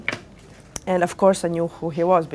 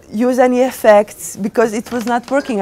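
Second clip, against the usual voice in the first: usual speaking voice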